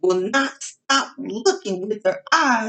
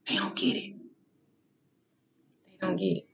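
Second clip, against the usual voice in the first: whispered aside; 11 dB quieter, faster than usual. Real, not acted. Muttered aside, unvoiced.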